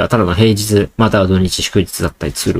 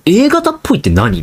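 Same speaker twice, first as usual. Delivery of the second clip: much higher, a mock baffled "A型っぽいって何？"; incredulous retort; 10 semitones higher than usual. Scoffing at people who call him type A, mock baffled. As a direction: toss back "A型っぽい" in pitched-up disbelief. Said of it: "みたいな".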